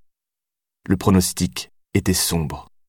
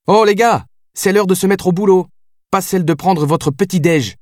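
high-pitched, raised captain's voice; barked order; 10 semitones higher than usual. A narrator's reading. Captain rousing her men from breakfast, barked. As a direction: bellow at the lads to get moving. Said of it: "éructa le capitaine Karine Agnelli".

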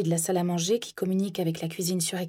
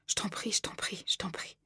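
whispered pleading; 9 dB quieter than usual. A narrator's reading. Pleading with violent husband.

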